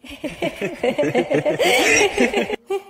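long rattling cackle; creepy laugh. Acted. Apparition taunting the girl about Mom, creepy long laugh. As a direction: cackle on and on eerily.

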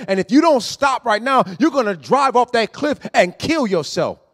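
loud, high preaching that builds; preaching at full force. Acted. Preacher warns the congregation of the cliff, loud and urgent. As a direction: thunder the warning at the congregation.

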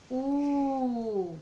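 exaggerated rounded "U", lips pushed forward; drawn-out U. Shown as a demonstration. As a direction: show the mouth shape on "U". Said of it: "Mire mi boquita". ("U" exagerada con labios redondeados)

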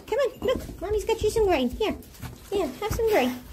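high, soft coaxing. Real, not acted. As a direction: gently coax the goat with grain. Gently coaxing goat.

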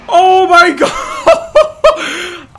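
laughing, screaming with joy; excited shriek. Real, not acted. Thrilled scream after a big jump.

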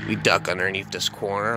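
plain reading voice

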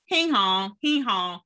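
mock chanting street taunt; jeering kids. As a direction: mimic kids calling out after someone. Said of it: "they would say".

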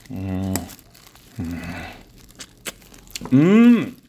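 sucking food off fingers; muffled hums while eating; chewing with mouth full. Real, not acted. Seen 1: Gloved fingers and chicken at mouth, chewing through 6.8s.

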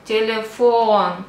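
telephone said the Russian way, tele-FOON, last vowel drawn out; stress on O. Shown as a demonstration. Teacher showing where the accent is, stressed O. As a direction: lean slowly on the O of telephone. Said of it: "The accent is on the O".

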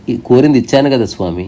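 ordinary talk, nothing marked

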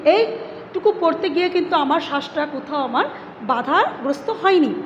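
ordinary delivery

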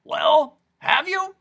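high, loud raspy demand; raspy insistence. A narrator's reading. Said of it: "which was so raspy".

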